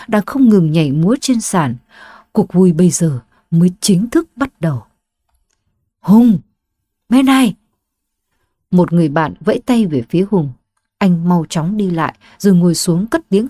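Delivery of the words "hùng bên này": calling out. Acted. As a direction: call loudly across a noisy bar. Friend calls across noisy bar.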